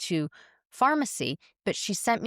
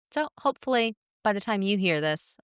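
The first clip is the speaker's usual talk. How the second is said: usual speaking voice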